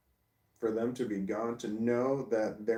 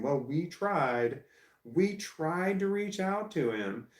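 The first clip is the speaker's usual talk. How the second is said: slow, higher, sing-song mockery of their excuse; mocking parents; 7 semitones higher, slower than usual. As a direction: parody their smug "we tried", dragging it out. Mocking the family's self-justification.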